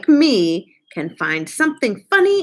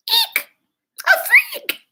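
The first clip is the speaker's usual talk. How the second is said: very high squealing cry; spooky shriek. Acted. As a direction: shriek it like a scared kid reciting a Halloween poem. Child performs spooky poem.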